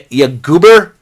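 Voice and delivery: normal talking voice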